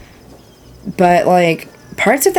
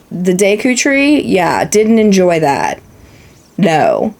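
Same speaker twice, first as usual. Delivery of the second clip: ordinary talk, nothing marked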